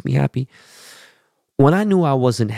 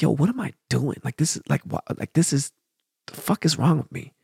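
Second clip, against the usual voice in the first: soft disbelieving self-talk, much quieter; muttering to himself; 4 semitones higher, 6 dB quieter than usual. Retold or imitated. To himself about throwing trash out the window, quiet muttering. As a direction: shake your head at yourself under your breath.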